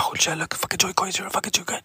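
excited hushed whisper of the crowd. Retold or imitated. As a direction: whisper it like gossip in the back. Crowd whispering as comic arrives.

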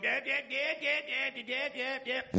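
high-pitched, rapid-fire parroting; mock yapping. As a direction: yap over someone like a little dog. Mimics the man talking over him.

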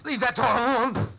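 loud sharp command; tense driver snapping at nosy attendant; angry order. Acted. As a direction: bark him off the door.

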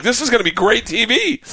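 high-pitched excited crew voice; mock hype. TV crew hyping the bear stunt, gleefully excited. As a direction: imitate a thrilled crew selling the stunt.

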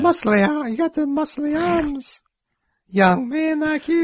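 put-on very high, drawn-out old-man voice; old man from Family Guy. Shown as a demonstration. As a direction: stretch it out in a thin, very high old man's pitch. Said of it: "he sound like this".

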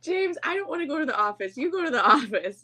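high, fast whining plea; whiny plea. Retold or imitated. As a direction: beg like a kid dodging chores. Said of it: "retold banter with James".

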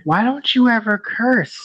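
put-on high, slow child's question; lightly mimicked child asking, drawn out; imitating a goddaughter. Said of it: "my goddaughter once asked me".

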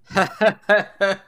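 put-on loud, high cackling drunk laugh; drunk laughter. Drunk man in the crowd mocking the Baron, loud laugh. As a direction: burst out laughing from the back of the crowd. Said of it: "a fellow just going".

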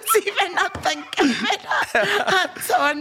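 laughing hard as she remembers; words squeezed out through laughter. Real, not acted. Laughs recalling her own story.

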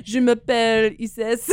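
slow mock-French introduction; playful fake French accent. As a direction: put on a hammed-up French accent. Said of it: "a phonetic French isis performance".

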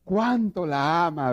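very slow, drawn-out sentimental sigh; film viewer gushing about the lovers, mocking and slowed; mock moved onlooker. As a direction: gush over the romance, slowly. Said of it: "dice el otro, para los otros".

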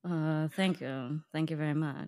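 lightly done [name] impression. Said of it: "Can you give us your best impression?".

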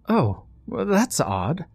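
light, puzzled high voice; Victoria voice. A narrator's reading. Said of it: "spoken by Victoria behind him".

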